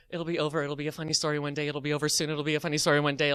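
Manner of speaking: low, soft, rapid self-soothing; muttered mantra. Retold or imitated. Teller calming himself while waiting in line, hurried soft mantra. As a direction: chant it under your breath, quickly. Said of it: "go over the mantra in my mind".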